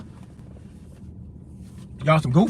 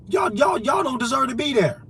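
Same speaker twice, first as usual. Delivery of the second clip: usual speaking voice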